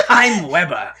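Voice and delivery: loud, hammed-up self-announcement; mock Webber proclamation. Co-host playing the composer, hammed-up proclamation. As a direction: announce yourself with silly pomp.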